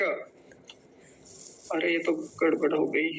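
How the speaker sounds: ordinary talk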